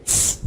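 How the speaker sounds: Ts as one crisp voiceless hiss; a single sharp ts; ts sound demo. Shown as a demonstration. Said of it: "É esse o som". (ts curto e sem voz)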